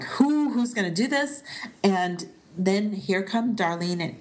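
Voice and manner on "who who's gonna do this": startled question. Retold or imitated. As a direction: jump up in alarm at the question. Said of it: "Elliot's like".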